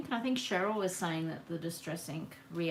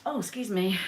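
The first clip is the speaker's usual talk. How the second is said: just after a sneeze; sneezing; 3 semitones higher, 7 dB louder than usual. Real, not acted. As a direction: talk through the sneezes. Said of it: "Now I've got the sneezes".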